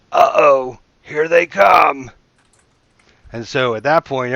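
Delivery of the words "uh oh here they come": loud, high warning shout, slow on here they come; guard's alarm yell. Acted. Guard on the wall warning the keep of attackers, loud yell. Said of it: "you hear a guard yell out".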